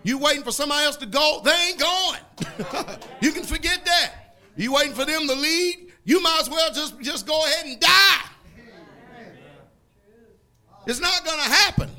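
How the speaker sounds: fervent preaching with a laugh. Acted. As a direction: preach it with full force. Preaching rises in force.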